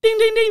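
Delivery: high chiming ding ding ding; correct answer ding. Pretend. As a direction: sing it like a quiz bell. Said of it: "the confusing was the ding".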